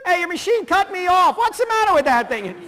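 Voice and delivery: loud, high mock complaint; chatty high-I friend. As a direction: protest excitedly into the answering machine. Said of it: "you'd be a high eye friend".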